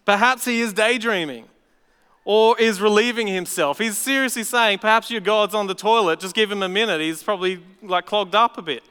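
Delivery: high, loud sarcastic mockery; jeering preacher voicing Elijah; mocking tone. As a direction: taunt them about their sleepy god. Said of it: "You can just hear the tone of voice".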